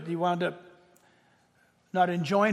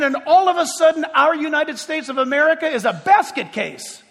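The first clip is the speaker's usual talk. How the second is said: high, raised pulpit voice; indignant preaching; 6 semitones higher, 5 dB louder than usual. Acted. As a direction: call out each outrage to the congregation. Preaches indignantly about the nation.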